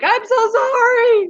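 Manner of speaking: high, slow, crying apology; mock sorrowful apology. Apologizing to feeder mice sold as food, slow high wail. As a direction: wail your sorry to the mouse. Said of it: "I'm always like".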